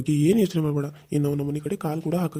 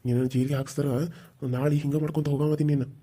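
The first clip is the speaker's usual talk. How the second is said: usual speaking voice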